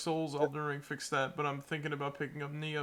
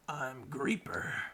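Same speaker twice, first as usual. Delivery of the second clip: creepy character voice; 3 semitones lower, 4 dB quieter, much slower than usual. Acted. Voicing game character intro.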